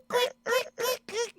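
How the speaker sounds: very high-pitched clicking noise; imitated spigot clicks. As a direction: squeak out the spigot's rapid clicks. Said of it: "they know the sound of the".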